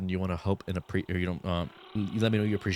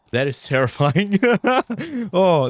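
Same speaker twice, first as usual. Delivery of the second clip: high shaky laugh through words; nervous laughter; 11 semitones higher, 7 dB louder, slower than usual. Real, not acted. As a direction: laugh off the fright. Laughing at the scare.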